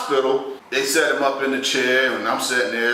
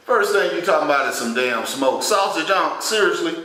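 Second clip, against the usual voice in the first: exasperated disbelief; 7 semitones higher, 3 dB louder than usual. Retold or imitated. Indignant at uncle's sausage talk.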